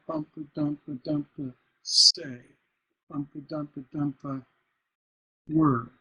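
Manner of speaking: rhythmic demo with stressed "dump" and light "a"; feminine then masculine endings. Shown as a demonstration. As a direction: chant the beats, "player" falling off, "say" landing firm. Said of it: "a feminine ending".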